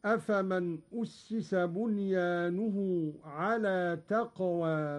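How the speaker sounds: slow recitation, "أسس" as ussisa and "هير" with imala; Qalun reading. Shown as a demonstration. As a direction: recite it slowly and lean the alif of "هير" toward e. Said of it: "هكذا".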